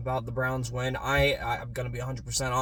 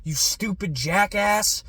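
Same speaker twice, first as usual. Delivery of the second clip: retold cursing; 5 semitones higher than usual. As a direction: drag out stupid jackass in contempt. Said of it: "cursing the Browns".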